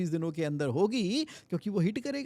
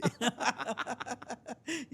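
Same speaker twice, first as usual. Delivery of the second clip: real laugh; 7 semitones higher than usual. Real, not acted. Jokes about finger calculator.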